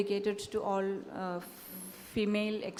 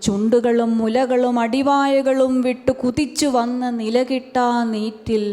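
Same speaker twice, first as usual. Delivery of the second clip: recital swells louder and higher to a near cry; impassioned climax; 5 semitones higher, 12 dB louder, much faster than usual. Acted. Poet reaching the poem's climax, impassioned loud recital. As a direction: pour it out in a rush of passion. Poem climax, building intensity.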